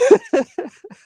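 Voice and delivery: loud, high-pitched burst of laughter. Real, not acted. Caller reacting to the host's thanks, laughing.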